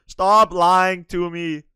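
loud, very slow accusation; angry accusation. Real, not acted. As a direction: drag out each word loudly. Accusing stranger of faking silence.

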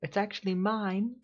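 squeaky mascot voice; high cutesy voice. Acted. Mascot speaks from hiding.

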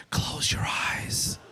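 slow, breathless unvoiced whisper; creepy whisper. Big hygienist whispering to a patient, creepy whisper. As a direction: hush it out slow and menacing. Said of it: "Just whisper".

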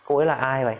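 ordinary talk

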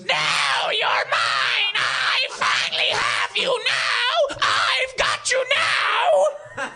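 high slow triumphant screech; villain shriek. Acted. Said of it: "The Mirror Man rises up and says".

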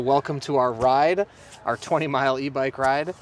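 usual speaking voice

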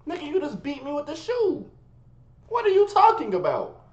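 high, loud indignant question; indignant outrage. Retold or imitated. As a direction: protest in disbelief. Indignant at dad.

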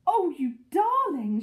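loud, high, drawn-out adoring cry; delighted cry. A narrator's reading. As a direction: gush over a beloved pet. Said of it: "she cried".

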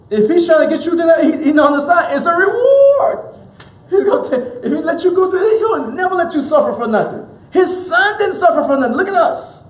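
rising joyful preaching with a laugh in it; excited preaching. Real, not acted. Preacher to the congregation, excited and laughing.

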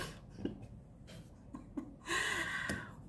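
high laugh; a short high laugh at herself. Real, not acted. Laughs at her own anger.

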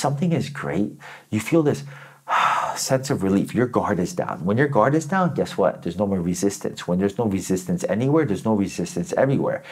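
ordinary delivery

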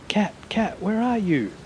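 high, urgent call. A narrator's reading. Boy searching for his cat in rain, calling out.

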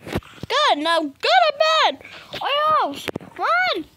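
high, loud shouted orders; furious countdown; angry shout. Acted. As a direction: yell the kid into bed. Parent ordering bed.

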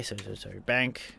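ordinary delivery

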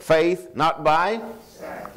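faith lifted high, not by sight slowed down; emphatic response. Acted. Call and response.

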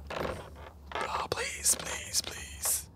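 breathy whispered please; whispered pleading. Real, not acted. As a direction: beg under the breath. Pleading during horror game.

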